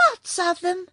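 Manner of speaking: Button-Bright's high-pitched cry; excited cry. A narrator's reading. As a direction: pipe it out high, as a small boy. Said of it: "cried Button-Bright".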